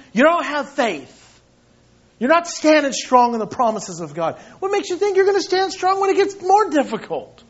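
loud, rising, pressing rebuke; rebuking preaching. Real, not acted. Pastor rebukes his church for weak faith, loud. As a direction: hammer the question home.

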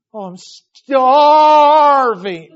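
loud, drawn-out mock wail; hammed-up hungry kid, very loud; passionate child's complaint. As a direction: wail it like an overdramatic starving kid. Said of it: "he's very passionate".

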